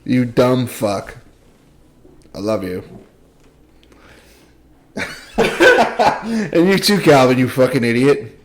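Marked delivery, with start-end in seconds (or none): none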